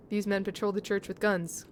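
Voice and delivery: usual speaking voice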